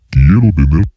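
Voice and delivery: processed monster growl; monster voice effect. Shown as a demonstration. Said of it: "aún no me convence".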